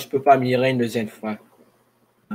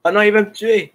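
high panicked cry; scared cry; 8 semitones higher, 6 dB louder than usual. Real, not acted. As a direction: yelp it in real fright. Zombie attacking him in game.

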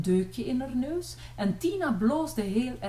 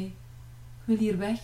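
plain reading voice

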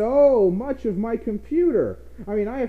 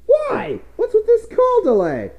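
high-pitched exasperated whine; pitched-up comic exasperation; exasperated outburst; 4 dB louder than usual. Acted. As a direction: wail the complaint way up high. Exasperated rant at Skype.

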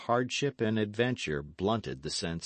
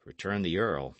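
ordinary delivery

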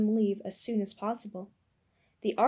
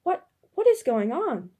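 higher and louder, bewildered; a father bewildered by the mob at his door; confused; 7 semitones higher, 7 dB louder than usual. A narrator's reading. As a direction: stumble into the question in shock. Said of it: "stared in confusion".

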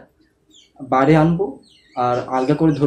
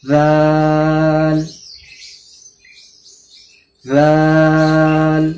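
রাল held very long, louder, thin mouth; Quran-style elongated letter; three-count stretch; 3 dB louder, much slower than usual. Shown as a demonstration. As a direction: draw রাল out for three seconds. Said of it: "তিন second টান দিয়ে পড়ছি".

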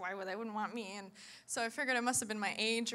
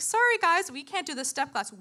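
higher and louder sorry guys; hammed-up instructor announcement; 7 semitones higher, 9 dB louder than usual. Telling twenty ladies class is cancelled, mock announcement. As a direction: call it out brightly to the class.

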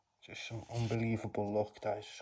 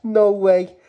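much louder, shouted disbelief; real shout of joy; excited shout; 10 semitones higher, 16 dB louder than usual. Real, not acted. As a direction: bellow it out, thrilled. Repeats after the squeal.

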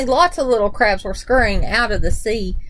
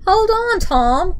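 raised, higher-pitched call; urgent cry; 5 semitones higher, slower than usual. A narrator's reading. Granddude to Tom fallen from his horse, urgent cry. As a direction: call out to someone in danger, mildly urgent. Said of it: "cried Granddude".

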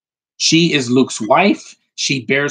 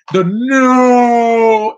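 long, loud, high wail of anguish; drawn-out mock cry of despair; Darth Vader; 10 semitones higher, 7 dB louder than usual. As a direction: bellow one endless mock-dramatic word. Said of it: "this is Darth Vader".

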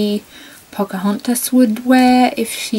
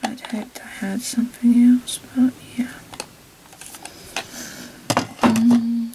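whispering; 3 semitones higher than usual. Real, not acted. Whispered disappointment.

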